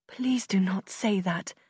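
ordinary delivery, nothing marked

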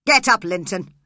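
father shouting angrily at his son; angry shout. Acted. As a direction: bark the order at your son. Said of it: "He shouted angrily to his son".

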